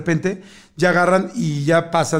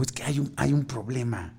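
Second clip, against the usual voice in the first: sheepish confession; 6 semitones lower, 11 dB quieter than usual. Retold or imitated. Confessing the mistake to the clerk.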